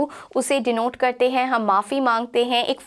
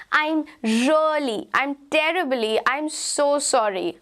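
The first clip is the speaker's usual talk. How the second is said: slow apology; 2 semitones higher, slower than usual. Shown as a demonstration. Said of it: "slow model apology".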